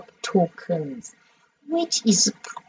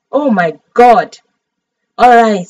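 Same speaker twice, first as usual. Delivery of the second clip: usual speaking voice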